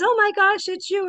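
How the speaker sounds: high, delighted cry of recognition; excited greeting. Retold or imitated. Woman thrilled to find friend at church, high excited. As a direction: gush with joy at seeing him.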